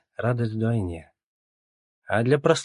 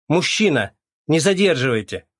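shouting; 6 semitones higher, 6 dB louder than usual. A narrator's reading. Clerk shouting at the man.

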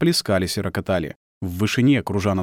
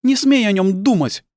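high, loud angry wife; angry prohibition; 11 semitones higher, 5 dB louder than usual. Acted. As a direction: forbid him fiercely, pitched high. Wife forbids husband, continuing her attack.

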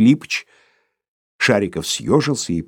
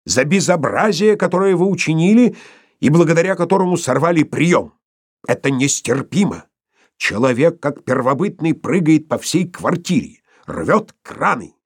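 raised, higher-pitched outrage; indignant tirade; 8 semitones higher than usual. A narrator's reading. The professor berates Sharikov for the wrecked reception, indignant. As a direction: build accusations with understated fury, pitched up.

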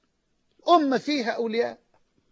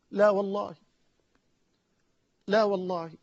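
usual speaking voice

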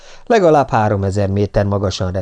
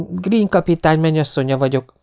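lightly voiced woman, higher pitch; woman's voice; 7 semitones higher than usual. A narrator's reading. Fiancée introducing herself to the officer, lightly feminine voice. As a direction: ring out higher, a hint of a woman. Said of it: "csendült fel újra a női hang".

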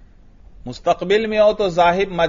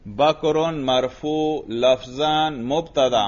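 slow, clear parsing read out; slow model parsing; slower than usual. Shown as a demonstration. Teacher slowly repeating the student's grammar parsing. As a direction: spell out each grammar term slowly. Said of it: "ترکیب کیجئے".

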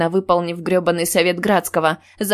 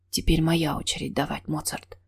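хриплый шёпот; 2 semitones lower, 7 dB quieter than usual. A narrator's reading. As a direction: murmur it huskily, fading on the last word. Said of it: "хрипло шепчет он". (тихий хриплый шёпот)

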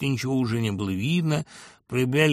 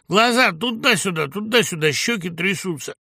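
raised, loud, emphatic mockery; blunt description; 6 semitones higher, 5 dB louder than usual. A narrator's reading. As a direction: lay out the evidence forcefully. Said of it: "рубил правду Абрамов". (рубить правду, с жаром)

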